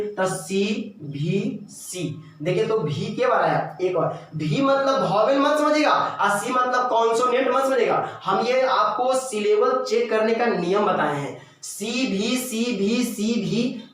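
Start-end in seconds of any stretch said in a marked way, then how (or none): 0.3-2.2 very slow, softer spelling-out; syllable-checking demo. Shown as a demonstration. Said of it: "तो check कर लीजिए". 11.6-13.8 evenly chanted letter count; syllable-counting demo. Shown as a demonstration. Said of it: "करते हुए check कीजिएगा".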